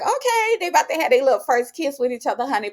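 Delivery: high-pitched, quick, excited squeal of delight; gushing like a fan about to see a first kiss. Retold or imitated. Vlogger gushing to viewers over a first kiss, excitedly high and fast.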